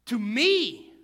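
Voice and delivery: ME drawn out, high and loud; loud, swooping stress on ME; emphatic answer. Real, not acted. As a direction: punch ME hard after the pause. Answers his own "who?" emphatically.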